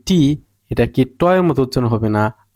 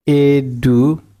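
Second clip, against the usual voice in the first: slow demo word; much slower than usual. Shown as a demonstration. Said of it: "আমরা একটা শব্দ দেখি".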